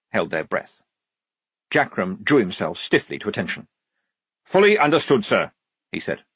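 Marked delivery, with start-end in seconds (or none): 4.4-5.5 raised military acknowledgement; stiff submission. Acted. Sergeant yielding to the lieutenant, stiffly formal. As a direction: answer as if at attention.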